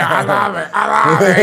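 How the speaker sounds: loud, high mock shout to a crowd; hammed-up gloating. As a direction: shout it like you relish the boos. Reveling in boos, retold.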